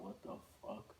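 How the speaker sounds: whispered what the fuck; whispered disbelief. Retold or imitated. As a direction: say it under your breath, stunned. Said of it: "muttered in disbelief".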